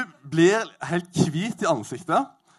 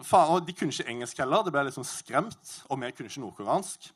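ordinary delivery

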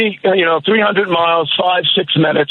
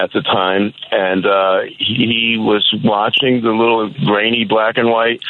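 ordinary talk, nothing marked

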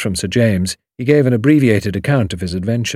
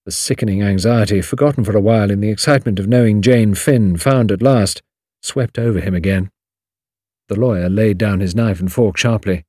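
usual speaking voice

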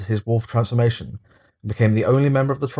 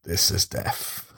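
grim reaper voice; slower than usual. Pretend. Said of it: "Squeak and the rat phone".

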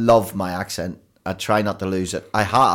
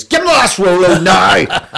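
shouted threat, much higher and louder; threatening shout; 10 semitones higher, 12 dB louder than usual. Retold or imitated. Hearing the accent as a threat, mock menacing shout. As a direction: bark it like a menacing order. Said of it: "What people hear is".

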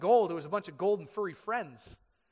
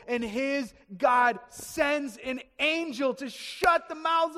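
slow, raised, emphatic proclamation; rousing preaching; 6 semitones higher, 6 dB louder, slower than usual. Real, not acted. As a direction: build higher and louder, stretching the phrases. Preaching at full force.

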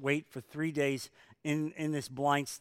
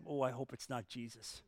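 mock dread; 4 semitones lower, 13 dB quieter than usual. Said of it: "I kind of think he said".

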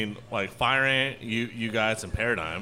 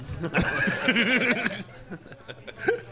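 high burst of laughter; hearty laugh; 13 semitones higher than usual. Real, not acted. Co-host's crude joke lands, high giggling laugh. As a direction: crack up at the joke.

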